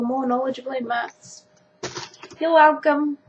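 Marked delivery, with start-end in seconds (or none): 2.4-3.2 smug. Real, not acted. Smug joke after lesson.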